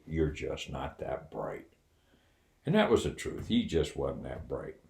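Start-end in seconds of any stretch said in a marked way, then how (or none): 0.0-1.7 quiet, slow, matter-of-fact dig; calm blunt insult said quietly and slowly; candid put-down. Retold or imitated. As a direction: drop it softly and slowly, dead candid. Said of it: "I said very candidly".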